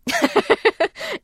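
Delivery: a short high burst of real laughter. Real, not acted. Telling a friend the strange ending, a high laugh. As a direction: laugh at how absurd the goodbye was.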